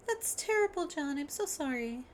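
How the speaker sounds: put-on very high, sympathetic girl voice; high cutesy character. Chum consoling John, high cutesy voice. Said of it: "It's so high".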